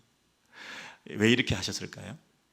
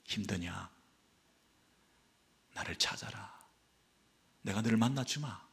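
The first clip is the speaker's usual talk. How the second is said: tender God's voice; 2 semitones lower, 9 dB quieter than usual. Acted. God voiced speaking to exiles.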